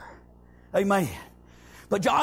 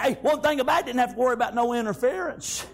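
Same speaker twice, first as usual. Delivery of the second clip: ordinary delivery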